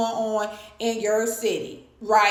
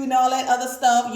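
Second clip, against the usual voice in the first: ordinary delivery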